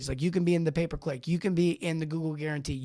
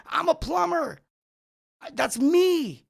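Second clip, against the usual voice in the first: high, shouted eager voices; clamoring crowd; 10 semitones higher, slower than usual. Retold or imitated. Plumbers shouting over each other to Google, high and eager. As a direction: yell to get picked out of the crowd.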